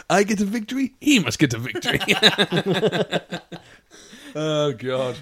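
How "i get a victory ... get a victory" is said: put-on loud comic Kazakh-style accent; put-on foreign accent, starting high then dropping; Borat. Boasting as the neighbour's rival, loud comic foreign accent. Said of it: "Borat's cousin".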